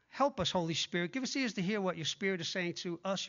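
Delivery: ordinary talk, nothing marked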